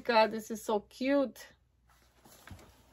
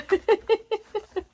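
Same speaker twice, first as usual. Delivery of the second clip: delighted laugh; 11 semitones higher, 6 dB louder than usual. Real, not acted.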